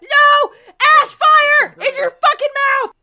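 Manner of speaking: high pained yell; pained shout. Retold or imitated. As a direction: scream at the burn. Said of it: "It's like".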